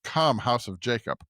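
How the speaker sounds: usual speaking voice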